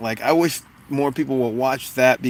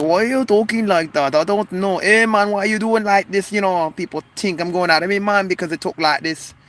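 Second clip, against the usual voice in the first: higher-pitched put-on Jamaican accent; fake Jamaican accent; 7 semitones higher than usual. Clowning for viewers about talking funny, fake Jamaican accent. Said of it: "Me Jamaican accent, it's fake an accent".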